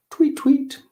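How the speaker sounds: very high chirp; bird tweets. Acted. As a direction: chirp brightly like birds. Birds chirp.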